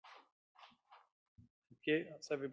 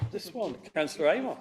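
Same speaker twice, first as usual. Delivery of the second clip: higher-pitched surprised query; 5 semitones higher, 4 dB louder than usual. Real, not acted. Chair calling on a councillor for the next item, surprised. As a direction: sound surprised to find whose turn it is. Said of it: "Don't sound so surprised".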